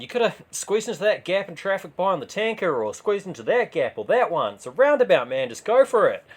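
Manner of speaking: loud, high mock yell at the driver; urging the driver on, loud and high; imagined yell. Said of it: "I want to yell at Uber man".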